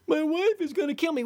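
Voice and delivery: high, whining mock complaint; sarcastic high whine of a panicked trader; whiny loser traders. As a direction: mimic a moaning trader, pitched high. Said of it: "these people that come on my side".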